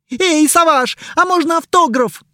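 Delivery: high, loud excited call; squealing fangirl. Acted. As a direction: call out to him like a starstruck fan. Fan calls out to star.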